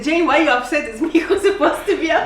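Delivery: mock outraged "why are YOU upset"; upset husband. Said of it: "See how she's so emotional".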